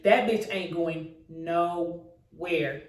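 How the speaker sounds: low, very slow gloat; slow smug brag. Real, not acted. Telling her ladies the car is dead, slow smug gloat. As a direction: rub it in.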